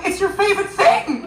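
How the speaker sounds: high, loud mock cheer; cheery consolation. Teacher cheering up crying Michael, high mock cheer. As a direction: try to cheer him up with high brightness.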